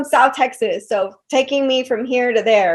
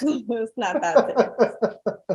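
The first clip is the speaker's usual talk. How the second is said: softer, slower, laughing through it; laughing denial; 3 semitones lower, 8 dB quieter, slower than usual. Real, not acted. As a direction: let the laugh take over the words. Repeats it laughing.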